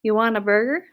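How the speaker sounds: loud question, rising at the end; eager loud hawking; called-out offer. Acted. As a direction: shout an offer across the stands. Berta still calling from concession stand.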